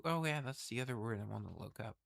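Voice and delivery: ordinary talk, nothing marked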